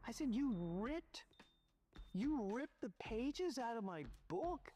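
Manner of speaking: demanding answers from the maid; angry confrontation. Retold or imitated. Confronting the maid over torn pages.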